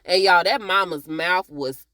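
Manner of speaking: ordinary talk, nothing marked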